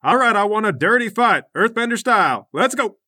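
high, loud fight announcer; referee voice. Acted. Judge opening an earthbender fight, loud referee call. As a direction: bark the rules and start the bout. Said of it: "The judge comes over and he goes".

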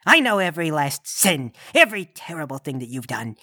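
low, measured demon of judgement; deep, deliberate menace; stern Krampus. Acted. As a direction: condemn the sinner in a low, looming voice. Krampus passes judgement on Sprinkle Winker.

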